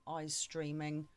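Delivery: usual speaking voice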